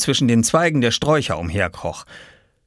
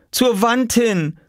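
high, slow, raised instruction; calling through door; 14 semitones higher, 3 dB louder, slower than usual. A narrator's reading. Mother guiding her son through the door, calling slowly. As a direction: call it slowly so it carries through. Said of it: "rief sie vor der Tür".

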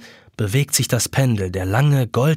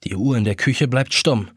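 ordinary delivery

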